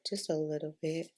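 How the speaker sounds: ordinary talk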